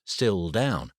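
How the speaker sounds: plain reading voice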